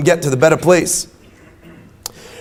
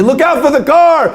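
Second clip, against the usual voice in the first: mock warning shout; 9 semitones higher than usual. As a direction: yell a fake warning as a prank. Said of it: "I say".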